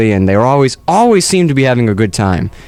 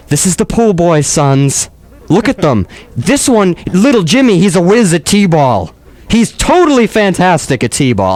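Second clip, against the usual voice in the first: higher-pitched camp New Yorker showing off; 4 semitones higher than usual. Acted.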